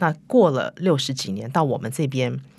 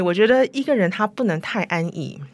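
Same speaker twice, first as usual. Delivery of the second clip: ordinary talk, nothing marked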